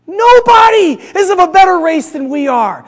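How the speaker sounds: loud, high, proud proclamation; hammed-up haughty outcry; entitled boast. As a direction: declare it like an indignant, superior sufferer. Acting the entitled sufferer boasting.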